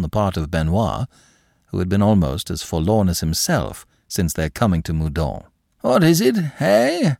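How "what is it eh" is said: startled. Acted. Startled seigneur rising.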